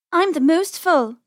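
slow, high-pitched sibling one-upmanship; childish boast. A narrator's reading. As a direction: brag like a competitive little sister, slowly. Rabbit siblings start boasting.

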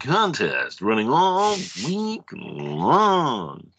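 hammy vintage radio host; old radio voice. Pretend. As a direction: swoop through it like an old-time broadcaster. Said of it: "my radio voice".

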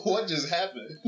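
high-pitched baffled reaction; mock bewilderment between laughs; bewildered. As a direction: retell it in amused, high-pitched disbelief. Retells own baffled reaction, laughing.

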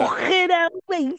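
high, throaty fake Scouse; bad Scouse accent. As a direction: overdo a terrible Scouse impression. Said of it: "someone trying to do a Scouse impression".

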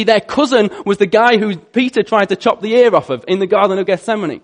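ordinary delivery

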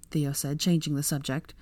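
ordinary delivery, nothing marked

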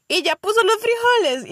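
high, loud motherly check-in; woman caller. Retold or imitated. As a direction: ask like a caring mom on the phone. Woman caller asking about beans.

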